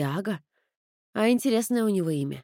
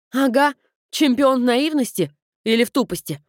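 venomous sneer; 5 semitones higher, 5 dB louder than usual. Acted. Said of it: "выплюнула ядом".

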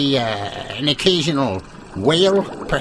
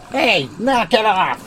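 slow, raised mock scold; 6 semitones higher, slower than usual. Reporter scolding a frog croaking in the background, raised. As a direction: tell the noisy frog off.

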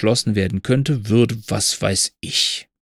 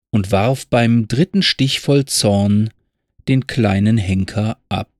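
slow, measured ballad reading; poem recitation; 3 semitones higher, slower than usual. Acted. Narrator reciting Pfeffel's poem to listeners, slow and measured. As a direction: recite it like an old story poem.

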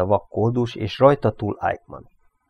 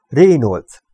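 louder, higher call by name; shouting; 4 semitones higher, 7 dB louder than usual. A narrator's reading. Eichmann calls after Reynolds on the platform, shouting. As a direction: call out to stop him. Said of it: "kiáltotta Eichmann".

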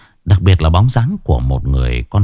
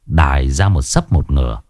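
ordinary delivery, nothing marked

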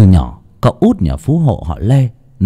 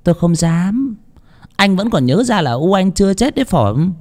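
higher, sulky and sarcastic mother; sulky sarcasm; 10 semitones higher than usual. A narrator's reading. Mother sulkily mocks her son for ignoring her, sarcastic. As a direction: needle him with wounded pride. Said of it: "bà nói giọng giận dỗi".

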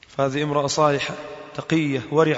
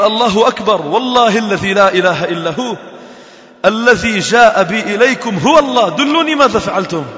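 awed loud exclamation; 5 semitones higher, 6 dB louder than usual. Retold or imitated. Doctor exclaims God is great.

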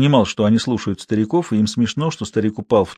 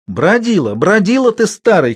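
high scolding cry; cross, high-pitched shout; old woman shouting; 8 semitones higher, 4 dB louder than usual. A narrator's reading. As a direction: scold an old husband across the dark. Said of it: "кричала во тьме старуха".

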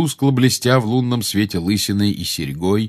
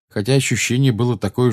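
plain reading voice

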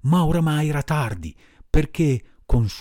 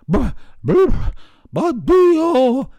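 high, loud dog voice broken by bleats of barks; high loud barking goodbye; barking dog's goodbye; 14 semitones higher, 6 dB louder, slower than usual. A narrator's reading. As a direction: bark a grateful farewell. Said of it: "rispose il cane".

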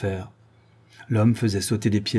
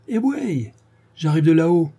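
high-pitched, drawn-up countryside reply; casual affirmation; 7 semitones higher, 4 dB louder than usual. Acted. Séraphin answers his friend's greeting, high and emphatic. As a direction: answer a pal with gusto.

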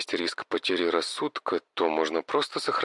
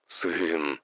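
the word catches in his throat; trembling voice; 5 semitones lower than usual. Acted. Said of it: "Голос мужчины задрожал".

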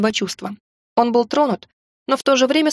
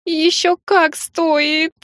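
tearful insistence; 7 semitones higher, much slower than usual. Acted. Heroine breaks into tears.

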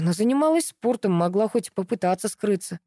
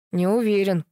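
ordinary delivery, nothing marked

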